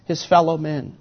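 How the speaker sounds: ordinary talk, nothing marked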